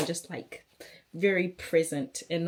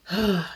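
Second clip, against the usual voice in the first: loud weary sigh; heavy sigh; 3 semitones higher, 5 dB louder than usual. Real, not acted. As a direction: sigh out the stress. Overwhelmed by questions.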